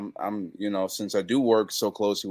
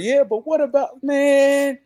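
high, louder eager fan voice; imitating a fan; 6 dB louder, slower than usual. A fan at the merch table, high eager mimicry. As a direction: mimic a pushy excited fan. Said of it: "he was just like".

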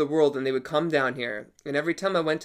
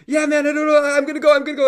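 much higher, hammed-up panicky whine; terrified friend; 12 semitones higher, 7 dB louder, much faster than usual. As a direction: imitate a friend convinced he's about to die. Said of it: "he's all shaking".

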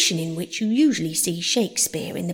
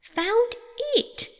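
high, snappish and cross. Acted. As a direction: answer back crossly. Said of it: "the Mouse replied rather crossly".

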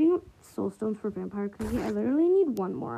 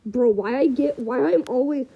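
ordinary talk, nothing marked

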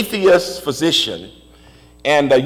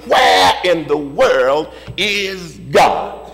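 emphatic sermon cadence, list rolling forward; rhythmic preaching; 4 semitones higher than usual. Real, not acted. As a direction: preach it in driving, repeated phrases.

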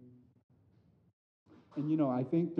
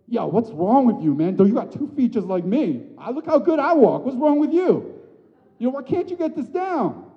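high, loud scolding dad voice; mock a harsh father jeering his toddler; mocking impatient dad; 12 semitones higher, 10 dB louder than usual. Father mocking his daughter learning to walk, loud scolding. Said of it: "I'm not like".

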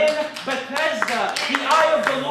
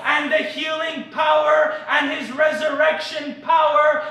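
loud, rising revival preaching; preaching at full force; 3 semitones higher, 4 dB louder than usual. Acted. Preacher rousing the congregation, full-force preaching. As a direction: proclaim it over the crowd.

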